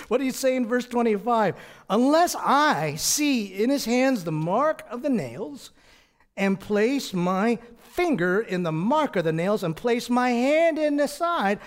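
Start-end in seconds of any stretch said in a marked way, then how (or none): 1.9-11.6 loud, slow, high-pitched emphatic refusal; dead-set doubter laying down his terms; emphatic defiant vow. Acted. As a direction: declare it forcefully, dragging out each key word. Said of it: "I don't know that he said it like that".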